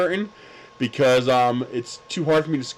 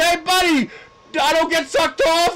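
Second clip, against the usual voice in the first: laughing shout. Retold or imitated. Shouted parting taunt.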